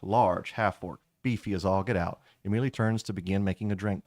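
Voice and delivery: usual speaking voice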